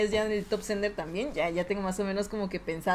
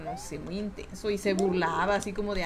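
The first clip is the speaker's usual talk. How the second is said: normal talking voice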